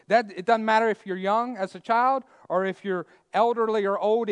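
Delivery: loud, high fiery preaching. Acted. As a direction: preach it out with full force. Preaching at full force.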